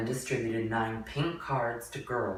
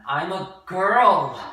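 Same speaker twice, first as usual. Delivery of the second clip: loud, high, slow cry; blaring cry; 9 semitones higher, 14 dB louder, much slower than usual. Acted. George's unspoken thought blaring as a cry. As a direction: blare it out from within. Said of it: "words that blared through her brain".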